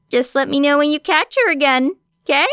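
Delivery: detective signing off casually; breezy detective. A narrator's reading. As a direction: toss it off breezily. Detective voiced higher.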